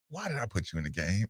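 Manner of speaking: quiet, low, quick coach's jab; retold disappointed coach. Coach to player he is upset with, quiet disappointed jab. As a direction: lightly imitate a coach's quiet, hurried rebuke. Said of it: "when he says".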